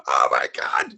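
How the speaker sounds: breathless laugh. Retold or imitated. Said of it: "like, crying, laughing".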